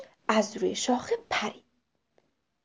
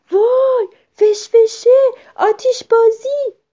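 high, loud burst of amazement; sudden excited shout; 12 semitones higher, 11 dB louder than usual. A narrator's reading. Boy spots something flying overhead, sudden excited shout. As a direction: cry out suddenly at a thrilling sight. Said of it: "پسره یهویی فریاد زد که".

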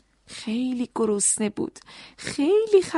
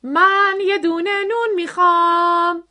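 loud shout; 4 semitones higher, 5 dB louder than usual. A narrator's reading. Noisy man ordering bread from the baker, shouting loudly. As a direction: holler it across the shop, stretching the last word. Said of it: "با صدای بلند گفت".

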